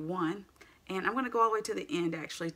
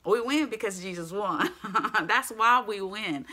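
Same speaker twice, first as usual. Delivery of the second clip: laughing and louder; 3 semitones higher, 6 dB louder than usual. Real, not acted. Preacher laughing with the congregation. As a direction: let the joy spill out as a laugh. Joyful laugh mid-sermon.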